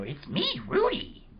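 put-on very high, squeaky little-kid Rudy voice; squeaky cartoon child saying his name; Rudy impression. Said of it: "that's a pretty good impression".

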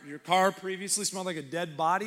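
ordinary talk

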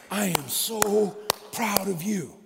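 slow, whispered words of pride; Paul whispering his pride to the Thessalonians; proud whisper. Acted. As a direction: breathe it out like a proud father. Paul praising the church.

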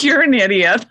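quoting her son through laughter; laughing son. Retold or imitated. Son laughing at mom who can't breathe underwater, laughing. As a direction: laugh while mocking yourself as the son would. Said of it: "he looks at me like".

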